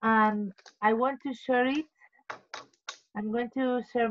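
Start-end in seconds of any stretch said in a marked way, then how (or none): none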